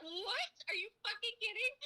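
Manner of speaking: high-pitched mock outrage; aghast retold outburst, played for comedy; incredulous outrage. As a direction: shoot way up in scandalized disbelief. Retelling outrage at unseen movie.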